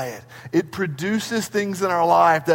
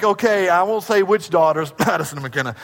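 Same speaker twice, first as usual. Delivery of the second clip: ordinary talk, nothing marked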